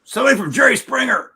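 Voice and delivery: high, loud holler of big news; retold roommate's shout. Roommate announces a Jerry Springer call, shouting. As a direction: act out an excited roommate yelling.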